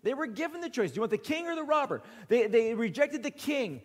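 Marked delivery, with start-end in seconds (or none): 0.9-2.0 quick retold question.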